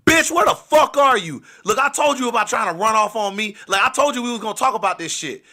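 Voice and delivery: loud, high-pitched angry tirade; loud confrontation. Retold or imitated. Jealous boyfriend bursting in on his drunk girl, loud and angry. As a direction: burst in yelling, demanding answers. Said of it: "I was being so loud".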